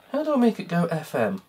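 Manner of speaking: normal talking voice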